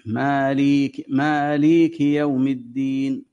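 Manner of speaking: مالك with its first vowel held far too long, slowly; overlengthened vowel. Shown as a demonstration. Teacher showing students the error of overlong مالك. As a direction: stretch the ma of مالك well past its length. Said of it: "فتشبع حرفا".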